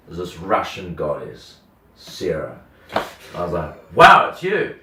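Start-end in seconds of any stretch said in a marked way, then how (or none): 3.9-4.7 high, loud exclamation of recognition; acted-out astonished greeting; delighted surprise. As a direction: burst out on recognising someone. Retelling surprise at finding Sarah.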